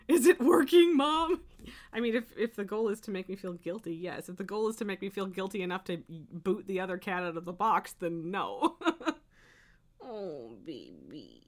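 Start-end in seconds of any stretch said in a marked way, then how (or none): 0.1-1.4 high, loud mock plea to Mom; playful put-on pet voice; pretend cat voice. Voices the sulking cat asking. 10.1-11.4 very low, soft pet talk; tender cooing. Real, not acted. Cooing at the sulking cat.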